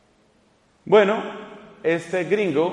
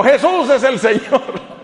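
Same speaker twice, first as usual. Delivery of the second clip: louder, higher joyful yell, laughing through it; mock shout; 7 semitones higher, 5 dB louder, slower than usual. Taxi driver shouting at each traffic light, laughing mock yell. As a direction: belt it out like a taxi driver at every red light. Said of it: "el taxista iba gritando".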